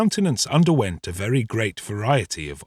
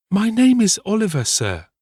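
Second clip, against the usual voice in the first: put-on high, louder boy's voice in astonishment; astonished boy; 10 semitones higher, 4 dB louder than usual. A narrator's reading. Little invalid corrects his wrongly given name, astonished. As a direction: pipe up high, taken aback. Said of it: "with a look of great astonishment".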